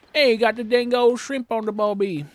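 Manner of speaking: put-on loud, high, purposely awful Australian accent; mocking Australian accent, fading as it goes; horrible Australian accent. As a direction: butcher an Aussie accent on purpose. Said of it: "I'm doing a purposely horrible accent".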